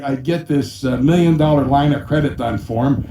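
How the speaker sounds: ordinary delivery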